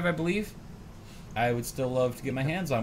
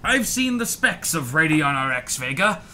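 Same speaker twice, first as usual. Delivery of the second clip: reading a leaked quote in a mock Scottish Sean Connery accent; hammed-up Sean Connery burr on a quote; 3 semitones higher, 5 dB louder than usual. Co-host reads the MSI rep's quote as Sean Connery. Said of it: "what accent is that?".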